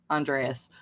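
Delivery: "Andreas" in an American pronunciation. Shown as a demonstration. Explaining how she says the name, American pronunciation. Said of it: "she pronounces it the American way".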